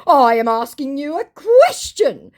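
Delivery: slow and insistent, like a stubborn scammer; mock scammer voice. Scammer insisting on his question, slow mock voice. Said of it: "To which he says".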